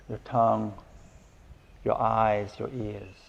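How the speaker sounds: unhurried meditation guidance with long pauses; guided meditation. Acted. Teacher settling listeners into breath and body, calm guided meditation. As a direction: guide them gently into stillness, leaving space between phrases.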